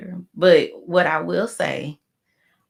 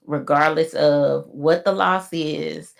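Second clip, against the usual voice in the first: ordinary talk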